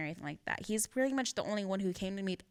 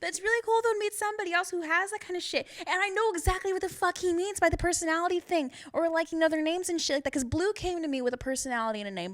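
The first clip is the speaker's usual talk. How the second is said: high, loud gush of delight; bubbling over, pitched high; excited; 10 semitones higher, 6 dB louder than usual. Real, not acted. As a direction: gush with thrilled recognition. Thrilled to meet someone similar.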